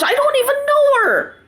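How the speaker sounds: exasperated disbelief. Real, not acted. Vlogger baffled by her hatred, exasperated high pitch. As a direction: voice jumps way up in disbelief.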